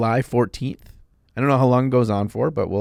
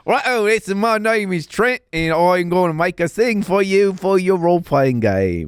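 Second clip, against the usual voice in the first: high, loud Australian accent impression; 8 semitones higher, 3 dB louder than usual. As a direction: put on a big Aussie accent. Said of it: "give me your best Aussie accent".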